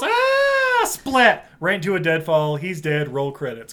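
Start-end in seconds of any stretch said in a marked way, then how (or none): 0.0-0.8 a high, loud hammed-up scream as he falls; mock falling scream. Voicing Mowgli's falling cry. 0.9-1.5 mock splat. Sound effect of the landing.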